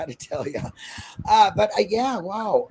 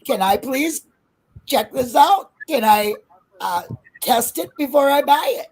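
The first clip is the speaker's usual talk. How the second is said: louder, pitched-up mock politeness; playfully bold customer; cheeky customer request; 5 semitones higher, 6 dB louder than usual. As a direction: ask brazenly across a shop counter. Acting out a cheeky store request.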